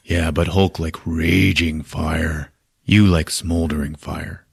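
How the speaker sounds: low, slow simple brute; Hulk. Retold or imitated. Hulk comparing himself with Thor, slow low imitation. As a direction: drag the words out low. Hulk talks in retold reconciliation.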